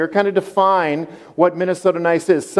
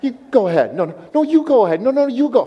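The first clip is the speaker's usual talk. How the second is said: higher and faster, insisting sweetly, no no you go; overly polite driver; 8 semitones higher, faster than usual. Pretend. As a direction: play the driver who won't take the turn, pitch climbing. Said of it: "four-way stop at Minnesota nice".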